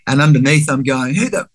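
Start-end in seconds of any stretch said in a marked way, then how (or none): 1.1-1.5 indignant inner voice. Retold or imitated.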